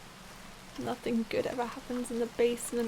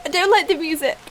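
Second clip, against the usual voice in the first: loud nervous complaint; 3 semitones higher, 11 dB louder, faster than usual. Real, not acted. Creeped out by horror music.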